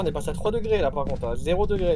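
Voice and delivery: ordinary delivery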